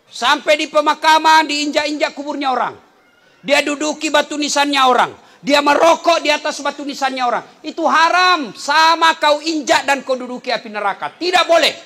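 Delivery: high, loud scolding preaching; stern scolding. Real, not acted. Preacher rebukes people who sit on gravestones, loud stern scolding. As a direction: scold the congregation hard.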